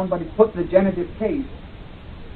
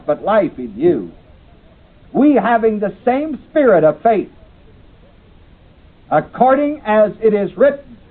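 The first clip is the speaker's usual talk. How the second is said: scripture reading; 4 semitones higher, 3 dB louder than usual. Acted. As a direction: read the Bible passage out with weight. Reads scripture aloud in class.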